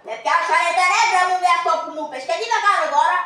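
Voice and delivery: loud agitated complaint; upset outburst. Acted. Betrayed husband rants loudly about his wife.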